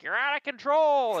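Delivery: high, slow taunting call; high mock whine; mocking yell. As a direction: throw his own whine back at him. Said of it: "I was like".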